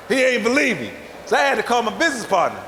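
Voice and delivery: ordinary talk, nothing marked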